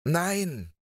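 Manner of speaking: high, drawn call of objection; calling out in protest. A narrator's reading. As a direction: cry out to contradict. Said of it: "wollte Gotthard eben dagegen rufen".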